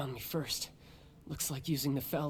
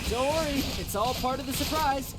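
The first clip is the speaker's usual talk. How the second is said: calling out loud; 9 semitones higher, 4 dB louder than usual. Acted. Brother calling out to Tooser from his hiding spot, high and loud. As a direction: call out high and loud over the noise.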